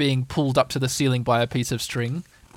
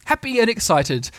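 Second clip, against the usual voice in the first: ordinary delivery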